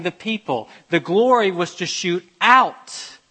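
loud, rising sermon climax; fervent preaching. Acted. Preacher urging his church to spread God's glory, loud and fervent. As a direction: drive it home to the congregation.

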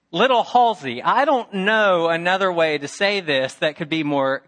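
hammed-up insistent uncle; emphatic warning. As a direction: lay it on thick for the boy. Uncle warns nephew emphatically.

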